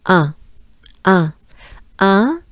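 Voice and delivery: nasal French un, mouth open. Shown as a demonstration. Teacher modelling the nasal French un. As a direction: say un through the nose, French style. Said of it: "we also have".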